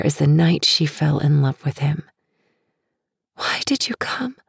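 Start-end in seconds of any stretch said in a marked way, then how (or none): 3.3-4.4 breathy high whisper. Acted. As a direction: whisper it, barely voiced. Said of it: "she whispers".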